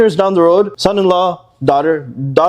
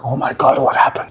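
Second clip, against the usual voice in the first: half-whispered mock shock, softer; mock shocked gasp; 3 semitones lower, 3 dB quieter than usual. Preacher imitating a stunned parent caught off guard, hushed mock shock.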